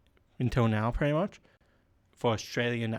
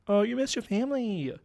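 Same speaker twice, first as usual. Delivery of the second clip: high, sing-song mock sympathy; mimicking a whiner; 10 semitones higher than usual. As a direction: taunt with fake pity, pitched way up. Mocks captive's plea back.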